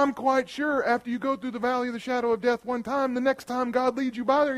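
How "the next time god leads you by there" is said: ordinary delivery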